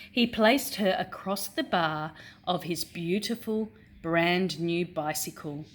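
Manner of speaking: ordinary talk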